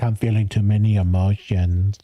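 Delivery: a lightly voiced whiny client; mock whiny client.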